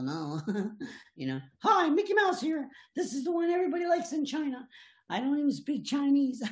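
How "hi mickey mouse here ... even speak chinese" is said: much higher squeaky cartoon mouse; high-pitched cartoon mouse greeting; Mickey Mouse voice. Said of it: "give us some of your characters".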